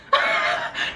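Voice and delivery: airy high-pitched laugh; high breathy laugh. Real, not acted. Laughs at her own joke.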